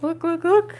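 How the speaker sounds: excited. Real, not acted. Showing off her pie.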